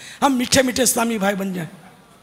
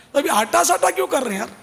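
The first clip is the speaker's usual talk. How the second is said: louder, faster, higher urging; fervent exhortation; 4 semitones higher, 6 dB louder than usual. Real, not acted. Preacher urging listeners to obey, rising to a shout. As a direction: build to a ringing appeal.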